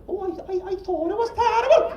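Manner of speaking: high, rising mock Indian accent; attempted Indian accent. Co-host reacting to the cat story, attempted Indian accent. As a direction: botch an Indian accent, climbing to a loud finish. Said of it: "can't do the accent".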